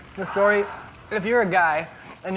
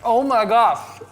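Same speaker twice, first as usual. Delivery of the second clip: shocked by heat; 4 semitones higher, 6 dB louder, slower than usual. Real, not acted. Just took a spicy bite.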